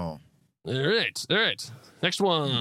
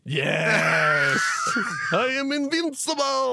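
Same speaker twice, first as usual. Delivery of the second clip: higher, hammed-up villain boast; megalomaniac rant; 8 semitones higher, 3 dB louder, much slower than usual. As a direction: crow it like a gloating villain. Repeats villain line higher.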